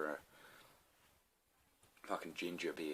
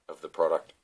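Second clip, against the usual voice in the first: burping; 7 dB louder than usual. Real, not acted. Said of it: "I'm burping, guys".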